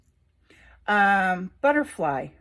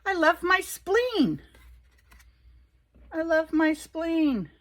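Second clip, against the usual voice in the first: higher, slow and drawn out, repeated; sing-song affirmation; 8 semitones higher, 3 dB louder, slower than usual. Acted. Reading her spleen card aloud, slow lilting high chant. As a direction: savor each word like a loving chant.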